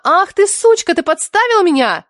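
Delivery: high, indignant outburst; high-pitched, scandalised accusation; playful outrage. A narrator's reading. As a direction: pounce on your friend with shrieked mock fury. Friend lunges at her, mock-furious.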